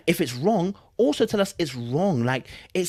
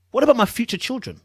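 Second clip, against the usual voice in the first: usual speaking voice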